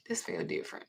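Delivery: hushed, breathy aside; uneasy whisper. Retold or imitated. Girl whispers her unease about the pastor. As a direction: whisper it like a private worry. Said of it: "I was like".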